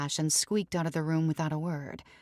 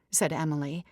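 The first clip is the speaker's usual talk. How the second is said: ordinary delivery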